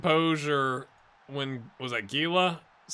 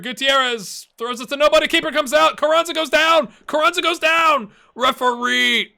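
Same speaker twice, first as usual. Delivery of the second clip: higher, louder play-by-play rising with the attack; excited commentary; 12 semitones higher, 12 dB louder than usual. Real, not acted. Gamer calling his own attack to viewers, excitedly. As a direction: call the chance like a hyped commentator.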